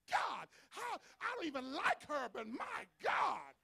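mock the jealous gasp; astonished enemies. Enemies seeing the blessing, hammed-up disbelief. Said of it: "they go".

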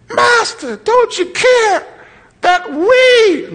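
high-pitched, desperate mock cry; hammed-up terrified plea; scared to death. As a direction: wail it like a panicked man in a storm. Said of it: "You scared to death of dying".

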